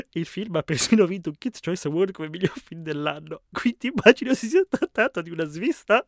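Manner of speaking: high, loud, laughter-filled reading; barely holding back laughter; can't stop laughing. Real, not acted. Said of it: "non riesco a smettere di ridere".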